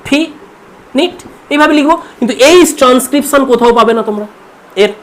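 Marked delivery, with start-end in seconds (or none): 0.1-1.2 ফি নিট with a short i in both syllables; wrong finite. Shown as a demonstration. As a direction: say ফি নিট, the common mistake. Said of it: "ফিনিট সাউন্ডটা কীভাবে লিখবো আমরা".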